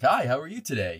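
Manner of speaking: lightly bright customer service greeting; breezy, overly friendly phone voice. Shown as a demonstration. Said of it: "demonstrate your customer service voice".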